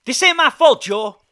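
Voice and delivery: loud, high-pitched self-defence; heated protest. A narrator's reading. Hank defending himself to angry Joe, shouting back. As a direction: shout back, denying the blame.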